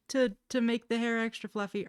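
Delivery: tired streamer loses patience, loud exasperated pitch; exasperated outburst. Real, not acted. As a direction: snap back in disbelief. Irritated rant at a chatter.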